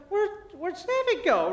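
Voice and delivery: high-pitched, retold parental concern; mock-alarmed dad looking around; sudden worry. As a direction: wonder aloud, suddenly uneasy. Dad notices toddler is too quiet.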